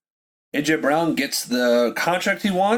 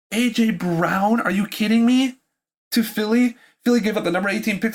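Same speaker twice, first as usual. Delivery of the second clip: stunned outburst of disbelief; shocked disbelief; 6 semitones higher than usual. Real, not acted. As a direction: react like the trade is impossible. Shocked by the trade news.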